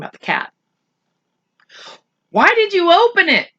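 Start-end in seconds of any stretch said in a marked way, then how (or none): 2.3-3.5 higher, strained question; a boy asking his mother, hurt; croaky boy's question. Acted. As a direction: push it out, hurt. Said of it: "in a croaky voice".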